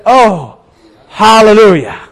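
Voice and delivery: drawn-out relieved exclamation; acted relief. Rider wiping his brow at the cliff edge, relieved exclamation. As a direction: let it out after a narrow escape.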